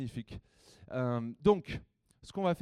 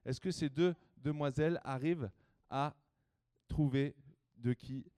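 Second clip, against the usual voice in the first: ordinary talk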